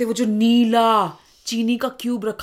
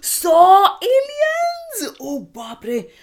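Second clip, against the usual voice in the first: high, very slow, loud disbelief; shocked; 11 semitones higher, 6 dB louder, much slower than usual. Real, not acted. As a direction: stretch out the shock. Hearing a hundred aliens.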